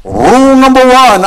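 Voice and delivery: a hammed-up Sean Connery Scottish brogue, high and loud; playful Sean Connery Scottish impression. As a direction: announce it in a mock Sean Connery Scottish accent. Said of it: "I announce in my best Sean Connery brogue".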